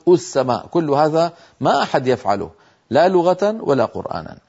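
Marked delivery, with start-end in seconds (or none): 0.1-0.7 السماء begun with "u", "us-samaa"; wrong opening damma. Shown as a demonstration. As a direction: say السماء as "us-samaa", first vowel u. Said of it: "ولا نقول".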